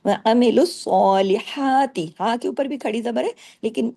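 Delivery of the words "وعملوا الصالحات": ص heavy and long, the rest light; slow recitation. Shown as a demonstration. Teacher models heavy and light letters, slow. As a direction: recite الصالحات with a heavy doubled ص. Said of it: "اس کا آپ نے خیال رکھنا ہے".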